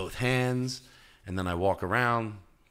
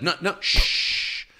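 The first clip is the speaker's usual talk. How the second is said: slow hushed shushing; hushing; 9 semitones higher, 5 dB louder, much slower than usual. Retold or imitated. Shushing a chatty guest, slow and hushed. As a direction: shush them gently but firmly. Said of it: "hushing the guest".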